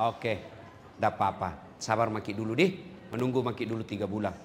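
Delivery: mock regent's calm reply. Quoting the regent.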